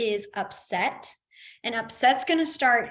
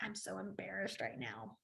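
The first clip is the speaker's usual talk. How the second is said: much softer, sheepish mumble; embarrassed; 3 semitones lower, 19 dB quieter than usual. Shown as a demonstration. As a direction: shrink away as you say it. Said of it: "that's what I think of".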